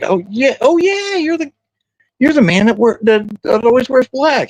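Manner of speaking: very high, squealed mock recognition; acted starstruck gushing. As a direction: play out a star sighting in a high falsetto. Retells gushing over [name].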